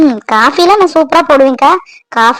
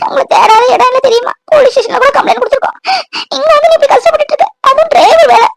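high-pitched tearful reproach; crying; 10 semitones higher than usual. Acted. Sister reproaches her long-missing brother, crying. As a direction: sob the complaint out, voice pitched way up. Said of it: "Divya, அழாத திவ்யா".

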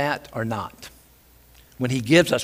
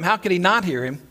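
normal talking voice

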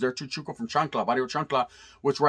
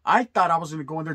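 usual speaking voice

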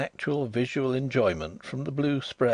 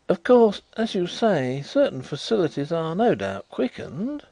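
higher, placating afterthought; hastily considerate; 8 semitones higher, 4 dB louder than usual. A narrator's reading. Visitor softening his doubt to blind friend, considerately. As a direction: hasten to soften the remark considerately. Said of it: "hastened to add considerately".

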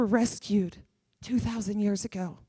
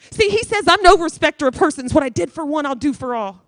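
louder, higher preacher's cry, full force; preaching at full force; 5 semitones higher, 9 dB louder than usual. Acted. Preacher to the congregation, preaching at full force. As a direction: proclaim it to the whole room.

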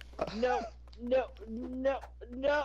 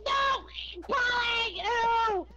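high, loud panicked yelling; panicked shout; 7 semitones higher, 5 dB louder than usual. Real, not acted. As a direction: scream it in real panic. Panicked at game attacker.

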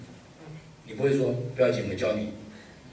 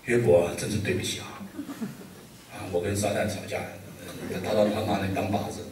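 mock apologetic God; 4 semitones lower than usual. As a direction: have God murmur a sorry, half laughing. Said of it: "神怎么样对约伯讲？".

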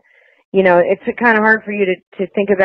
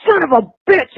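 higher, sharp mock outburst of fury; retold angry curse; 9 semitones higher than usual. Husband hearing the news, imitated angry curse. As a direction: spit the curse out like he just lost it. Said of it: "and flipped out".

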